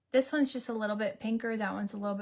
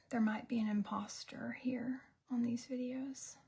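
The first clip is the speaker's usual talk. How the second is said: fast conspiratorial whisper; scared whisper; 6 dB quieter than usual. Real, not acted. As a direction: whisper like someone may be listening. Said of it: "I'm scared".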